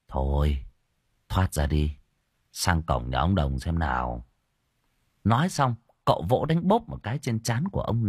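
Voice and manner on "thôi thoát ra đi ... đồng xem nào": low-pitched, awkward resignation; sheepish. A narrator's reading. The master tells the ghost to come out, sheepish. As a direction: say it low, a little embarrassed. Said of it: "Cậu Tẩy ngại ngùng".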